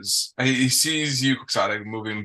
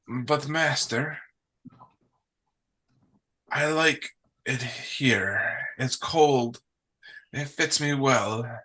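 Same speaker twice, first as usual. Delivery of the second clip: timid penguin; 4 semitones higher, slower than usual. Acted. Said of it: "cower down a little bit, and he goes".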